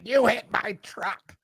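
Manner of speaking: a hint of a confused old woman blaming him; mild mock old lady accusation; imitating old woman. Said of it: "she's like".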